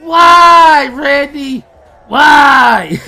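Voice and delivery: loud, high mock-anguished cry; hammed-up heartbreak, shouted; heartbroken wail. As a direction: wail it out like a melodramatic lament. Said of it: "I was heartbroken".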